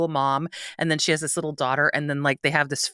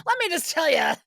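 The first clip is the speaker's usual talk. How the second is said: oversharing chatter; 10 semitones higher than usual. Retold or imitated. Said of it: "I'm like".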